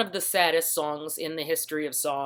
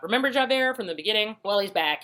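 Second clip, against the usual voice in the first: ordinary talk, nothing marked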